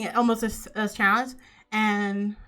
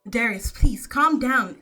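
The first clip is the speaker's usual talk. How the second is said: raised, louder urgent appeal; urgent plea; 3 semitones higher, 6 dB louder than usual. Acted. Aunt grabbing Darius' arm to stop him, urgent plea. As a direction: plead for calm, voice lifted. Aunt pleading with angry uncle.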